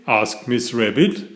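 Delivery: usual speaking voice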